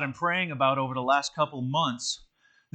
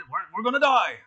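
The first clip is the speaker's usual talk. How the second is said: higher-pitched, half-hearted Scottish impression; bad Scottish accent; 6 semitones higher, 4 dB louder, faster than usual. Scotty warns the crew under fire, rough Scottish accent. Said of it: "that's not a very good Scottish accent".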